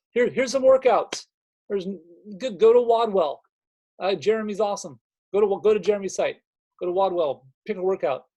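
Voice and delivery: high, loud mocking send-up of a lazy coach; mock careless coach. Coach mimicking someone just handing clients workouts, mocking.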